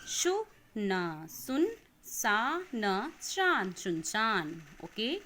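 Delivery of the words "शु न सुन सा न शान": slow broken-up reading, each syllable apart. Shown as a demonstration. Teacher breaks words into syllables slowly for children. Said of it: "आप इनको तोड़ तोड़ के पढ़ेंगे".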